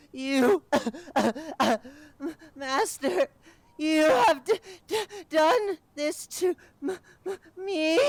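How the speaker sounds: very high, loud sobbing accusation; sobbing creature. Acted. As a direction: cry out the blame.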